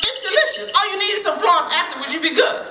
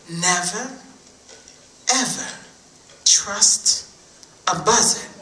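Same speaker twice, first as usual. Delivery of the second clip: mama's warning to never trust a buzzard; solemn warning; 7 semitones lower, 3 dB quieter than usual. Acted. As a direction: lean in and warn them word by word. Mama's warning, slow emphatic.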